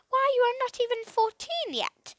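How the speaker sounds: high, protesting aunt voice; incredulous aunt. Acted. Aunt Polly objects to Philip quitting school, incredulous. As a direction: gently scold the boy's wild idea.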